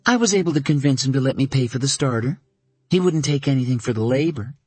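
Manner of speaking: Caroline, a little flustered; lightly voiced Caroline. A narrator's reading. Caroline telling Dana how David fixed her car, lightly voiced.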